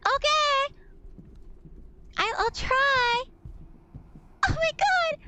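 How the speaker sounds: high, frightened squeal; real high-pitched panic; panicked. Real, not acted. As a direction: squeal it as the nerves take over. Facing scary monster in game.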